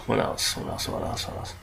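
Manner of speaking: very soft, low murmur; trailing, barely voiced self-prompt; muttering to self. Real, not acted. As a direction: think aloud under your breath. Thinking aloud between review points.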